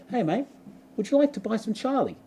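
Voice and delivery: high-pitched, courteous salesman's offer; classy drug dealer. Retold or imitated. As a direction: imitate a gentlemanly street dealer.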